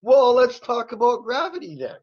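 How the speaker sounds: high mocking voice; mocking quote. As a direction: imitate him, amused and high. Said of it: "he just thought that was hilarious".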